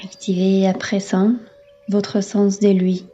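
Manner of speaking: ordinary talk, nothing marked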